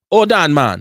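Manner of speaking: put-on loud Jamaican accent with HOLD punched. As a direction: call out in a jokey Jamaican accent. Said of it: "Was that a good Jamaican accent".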